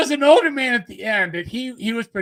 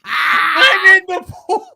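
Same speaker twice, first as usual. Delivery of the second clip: near-tears laughter, higher and louder; laughing hard; 8 semitones higher, 8 dB louder, much slower than usual. Real, not acted. Teller recalling the pool scene, laughing until crying. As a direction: gasp the words through helpless laughter.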